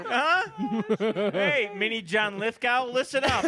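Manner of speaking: loud, slow, laughing jeer; mock him through laughter; laughing taunt.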